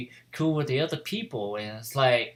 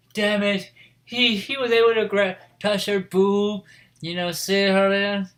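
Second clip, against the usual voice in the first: retold jealous complaint; 8 semitones higher, 4 dB louder than usual. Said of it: "he was just pure jealous".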